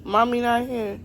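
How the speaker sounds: loud teasing playful voice; pretend mommy voice. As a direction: keep teasing the child. Teasing child, pretending absent.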